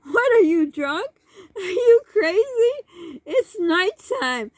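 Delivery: mock-scold in disbelief through leftover laughter; incredulous scolding. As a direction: imitate an exasperated mother, high and loud. Friend's mother scolds mom.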